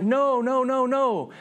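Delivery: high, anguished repeated refusal; grief. Acted. God realising what man has done, grieving. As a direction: wail it mildly, drawing out each no. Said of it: "there's grief, there's sadness".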